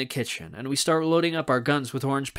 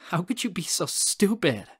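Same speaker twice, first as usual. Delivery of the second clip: exasperated scolding; 5 semitones higher than usual. A narrator's reading. Friend scolds narrator in story.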